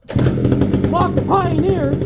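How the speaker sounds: loud, drawn-out angry shout; slow heated shout. Real, not acted. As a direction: bellow it out slowly. Heated rant against the saw brands.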